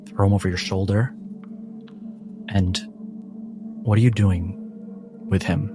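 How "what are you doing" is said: normal talking voice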